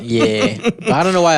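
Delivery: laughing while talking; words broken up by laughter. Real, not acted. Laughing after a joke.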